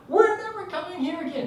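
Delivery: high, loud mock hysteria; shrieked vow, imitating a frightened wife; panicked outburst. Wife refusing to return to cabin, hysterical. Upset wife quoted after bear scare.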